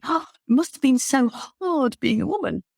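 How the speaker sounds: a hint of a wide-eyed schoolgirl asking; mock schoolgirl question. Astronaut imitating schoolgirls asking her, lightly gushing. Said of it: "a load of girls will be asking".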